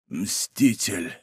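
low, soft last word; dying gasp. A narrator's reading. Wounded Winter in Athos's arms, dying murmur. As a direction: let the word out faintly.

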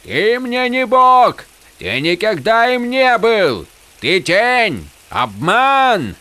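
high, forceful shouting; outraged cries, very high-pitched; furious denunciation. A narrator's reading. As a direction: hurl each accusation at the sky. Hero denounces the god.

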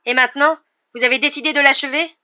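loud, high, accusing question; angry challenge. A narrator's reading.

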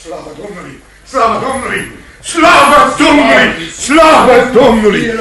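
growing from murmur to ecstatic cry; building exultation. Acted. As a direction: let the thanks swell into a triumphant shout. Judge rejoices at confession.

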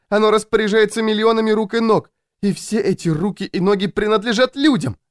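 high, plaintive woman's voice; persecuted Louisa. A narrator's reading. Louisa confides she is under attack, plaintive. Louisa's mounting paranoid complaint.

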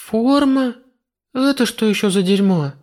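high shocked question; shocked disbelief. Acted. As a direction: spit it out in disbelief, pitched high. Heroine shocked by demon.

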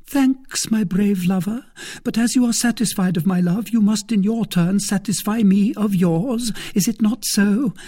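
high-pitched woman's character voice; high, lively feminine lilt; Milady, coaxing lover. A narrator's reading. Narrator voices Milady to her lover.

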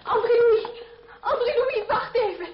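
loud, high call after someone walking off. Acted. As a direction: shout his name down the street to stop him. Calling after departing André.

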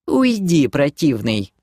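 high-starting coy dismissal; dismissive brush-off. Acted. Demoness brushes off the doc, dismissive. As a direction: wave him away pettishly. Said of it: "отмахнулась демоница".

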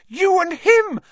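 very high, loud outraged cry; screamed accusation. A narrator's reading. Hermione screaming accusations at Lupin.

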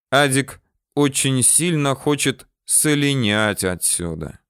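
ordinary delivery, nothing marked

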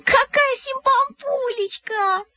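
very high, slow squeal of delight; squeaky. A narrator's reading. Irka Mukhina squeals over the clay pig. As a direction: squeak like a thrilled little girl. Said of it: "запищала Ирка Мухина".

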